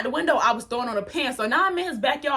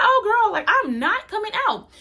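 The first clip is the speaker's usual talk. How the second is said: high, sassy refusal; mock defiant; 10 semitones higher, 3 dB louder than usual. Hiding girl answering the stepmom in her head, sassy refusal. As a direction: toss off the refusal, pitched up and cheeky. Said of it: "and I'm like".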